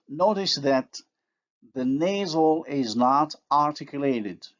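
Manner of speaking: normal talking voice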